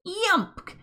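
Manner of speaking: squeaked yump. Acted. Friday squashed by Polly, high yelp. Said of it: "he exclaimed".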